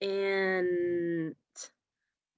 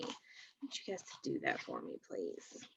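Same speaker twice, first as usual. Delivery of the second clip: whisper; 7 semitones lower, 18 dB quieter than usual. Real, not acted. Teacher drops to a whisper.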